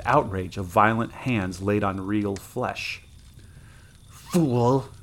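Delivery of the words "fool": restrained, tight anger; gritted insult. A narrator's reading. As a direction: force it out through gritted teeth. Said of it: "gritted the girl between her teeth".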